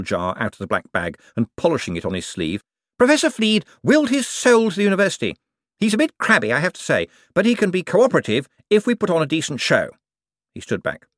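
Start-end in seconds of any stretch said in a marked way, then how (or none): 3.0-9.9 high, eager, bright-voiced wizard; a jumpy official covering for himself. A narrator's reading. Hicks describing the professor.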